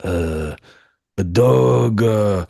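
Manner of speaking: mock Japanese accent; halting put-on Japanese accent. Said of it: "should have had your Japanese accent out".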